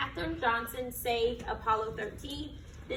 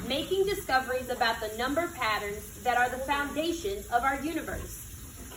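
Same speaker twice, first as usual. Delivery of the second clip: put-on reading to children; 4 semitones higher, 5 dB louder than usual. Teacher reading a picture book to her class, storytelling voice. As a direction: read it like storytime on the rug.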